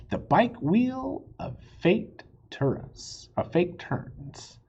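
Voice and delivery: slow, drawn-out title reading; chapter title. A narrator's reading. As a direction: announce the chapter title slowly, with weight. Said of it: "Chapter fourteen".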